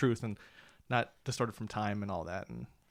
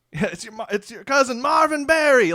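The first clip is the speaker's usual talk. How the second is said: high, loud quoted line; movie character impression; 12 semitones higher, 11 dB louder than usual. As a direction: shout the quote gleefully. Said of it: "versus, like".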